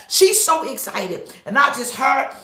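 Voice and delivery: ordinary delivery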